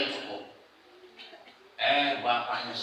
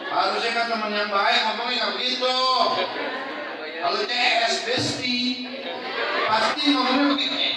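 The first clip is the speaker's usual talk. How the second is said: high, loud preacher's punchline; fiery sermon climax; preaching at full force; 11 semitones higher, 7 dB louder than usual. Acted. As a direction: drive it home to the congregation.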